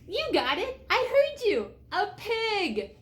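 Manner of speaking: usual speaking voice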